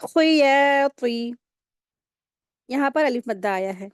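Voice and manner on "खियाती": खियाती with खी short, या stretched two beats, ती heavy; khi quick, ya long. Shown as a demonstration. Teacher to class, modelling the word: quick खी and a drawn-out या. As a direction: clip खी, hold या, weight on ती. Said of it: "खी को जल्दी पढ़ेंगे, या को दो हरकत खींचेंगे".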